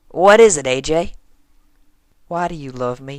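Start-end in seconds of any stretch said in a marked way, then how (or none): 0.1-1.1 higher, louder what is it; loud surprised question. Acted. AJ asking Rainbow, startled and loud. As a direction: jump up startled.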